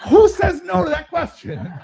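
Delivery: high-pitched, amused disbelief; incredulous aside. Real, not acted. Speaker to audience about the operator's question, high incredulous exclamation. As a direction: exclaim it to get the laugh.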